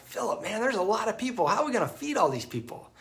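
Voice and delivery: Jesus playfully testing Philip with mock concern; playful teasing. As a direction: play along with a smile. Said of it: "picture Jesus kinda playing with him".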